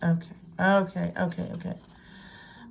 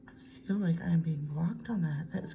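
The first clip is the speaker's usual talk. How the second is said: hushed whisper, almost to herself; 7 dB quieter than usual. Real, not acted. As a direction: softly whisper the doubt. Murmuring about being blocked.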